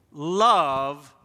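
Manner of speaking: love punched loud; stress on love. Acted. Pastor to congregation, love punched loud. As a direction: hit the word love hard. Preacher punches key word.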